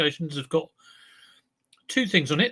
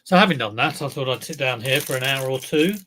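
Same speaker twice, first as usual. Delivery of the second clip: ordinary talk, nothing marked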